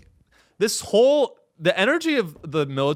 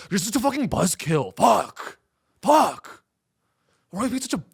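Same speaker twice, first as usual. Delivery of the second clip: higher, mock teen whine; a hint of a bratty teen grumbling; military as a whining teenager; sulky teenage boy; 4 semitones higher than usual. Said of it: "they're teenage boys that never grew up".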